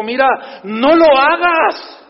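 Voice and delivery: stern angel. Acted. Angel voiced forbidding John.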